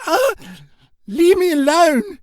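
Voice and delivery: high loud panicked shout; frightened yell. Acted. Serena shouts at the bat in panic. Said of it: "she yelled".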